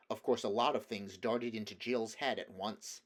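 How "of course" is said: plain reading voice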